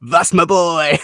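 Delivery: imitated New Yorker catchphrase; New Yorker accent. Quoting a favourite line from the episode, New Yorker accent. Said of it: "It's a typical New Yorker accent".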